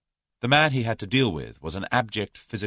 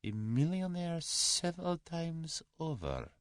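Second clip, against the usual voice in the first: soft, high, slow musing murmur; 12 semitones higher, 9 dB quieter, slower than usual. A narrator's reading. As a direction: murmur it slowly to yourself. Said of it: "murmured Kremenin".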